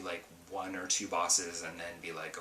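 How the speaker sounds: ordinary talk, nothing marked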